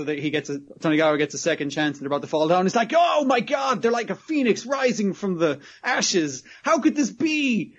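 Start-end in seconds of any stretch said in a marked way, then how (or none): 3.0-7.7 high, hammed-up cry of amazement; mock freak-out. Host watching balls rise again, mock freak-out. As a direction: wail it theatrically, stretching the last phrase. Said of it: "like, freaking out".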